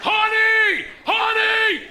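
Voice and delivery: loud, high holler, held long; screamed out loud. Retold or imitated. Husband screaming to wife in the produce section, real loud. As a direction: yell it across the whole store. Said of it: "I scream out li-, real loud".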